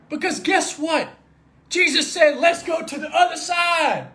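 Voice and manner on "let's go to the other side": slow, shouted declaration; loud, drawn-out proclamation; proclaimed command. Acted. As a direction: call it out across the water, slowly. Quoting Jesus, proclaimed.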